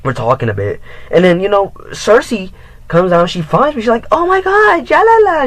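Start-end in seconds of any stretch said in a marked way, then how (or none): 4.1-5.5 very high, loud mock squeal; shrieked hello in a girly voice; squealing girl greeting. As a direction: gush over him like an excited girl. Ex greets him excitedly.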